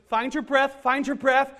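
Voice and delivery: yoga teacher calling "find your breath" over the room; yoga instructor. Retold or imitated. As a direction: coax the class like an instructor. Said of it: "she goes".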